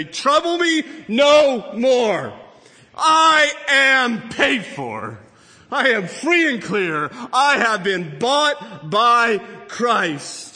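loud, high, slow proclamation; ringing declaration of freedom; triumphant defiance. Acted. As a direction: shout down the accuser, drawing out each word. Believer's defiant answer to the accuser.

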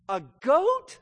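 high, loud "goat" swooping up; incredulous father. Acted. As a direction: question GOAT in mock astonishment. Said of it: "the fathers must be shaking his head".

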